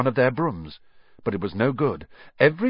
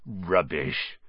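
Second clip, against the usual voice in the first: Rubbish said low and soft, brushing it off; a quiet low Rubbish from the half-giant; lower, softer dismissive rubbish; 6 semitones lower, 4 dB quieter than usual. A narrator's reading. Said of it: "said Hagrid".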